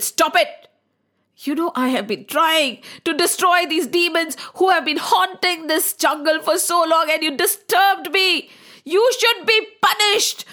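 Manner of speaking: the sage scolding the king for breaking his penance. A narrator's reading. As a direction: scold him, building to a threat.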